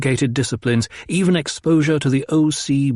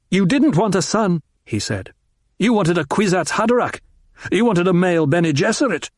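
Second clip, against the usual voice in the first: high, hard accusing lines; bitter; 6 semitones higher than usual. A narrator's reading. Son accusing his mother of wanting a tool, bitter. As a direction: spit it out with bitterness. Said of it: "She recoiled from his bitterness".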